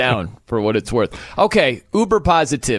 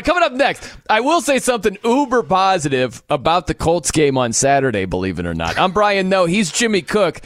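ordinary talk

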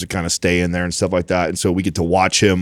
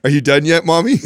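put-on higher, piping little boy asking; little kid voice; 7 semitones higher than usual. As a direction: chirp it like an impatient toddler. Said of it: "He asks her".

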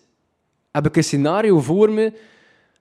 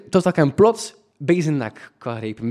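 ordinary talk, nothing marked

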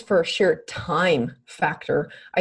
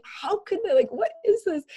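incredulous; 12 semitones higher than usual. Retold or imitated. Retold incredulous reaction.

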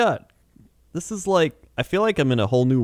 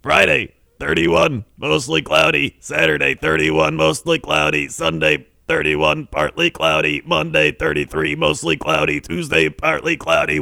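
measured announcer forecast read; weather forecast read; 3 semitones higher than usual. Acted.

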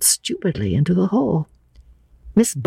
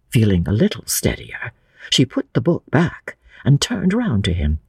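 usual speaking voice

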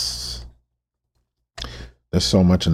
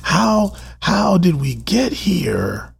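pleading with God; 11 semitones higher, much slower than usual. Real, not acted. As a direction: plead it slowly and helplessly. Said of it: "I'm asking God, like".

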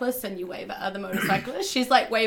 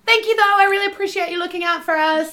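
sweet thanks; 10 semitones higher, 10 dB louder than usual. Acted. Character thanking the guard.

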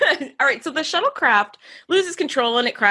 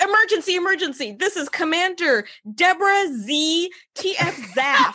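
higher, self-important radio call; mock officious officer; 8 semitones higher than usual. Mocking the commander's long radio introduction, higher and pompous. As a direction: announce yourself pompously, pitched up. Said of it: "it's like".

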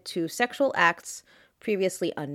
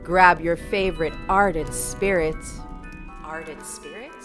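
sultry intro read. Acted. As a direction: purr it like a naughty invitation. Seductive podcast intro over music.

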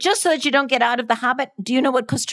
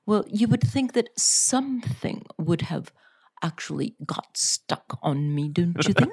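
put-on English accent; 4 dB quieter than usual. Co-host who lived in Britain, keeping up English accent. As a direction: draw out the lofty British accent. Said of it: "her version of an English accent".

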